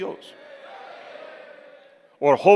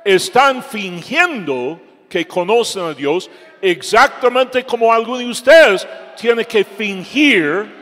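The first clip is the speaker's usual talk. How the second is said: higher, louder full-force rebuke; preaching shout; 6 semitones higher, 6 dB louder than usual. Real, not acted. Preacher calls out young people faking faith, shouting higher and louder. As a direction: thunder it at the hypocrites.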